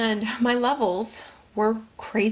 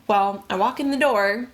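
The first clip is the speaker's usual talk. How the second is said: ordinary talk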